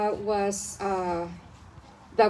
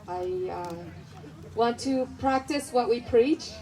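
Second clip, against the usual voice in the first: usual speaking voice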